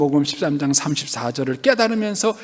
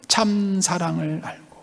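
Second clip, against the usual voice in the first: slow and softer, 참 drawn out; hushed emphasis; 6 dB quieter, slower than usual. Real, not acted. Preacher repeating true love to church, softly emphatic. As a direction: repeat it gently, lingering on 참.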